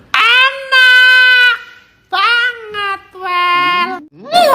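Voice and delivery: high, drawn-out sung "enak banget"; singsong delight. Real, not acted. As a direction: belt out the praise like a song. Tasting, drawn-out sung exclamation.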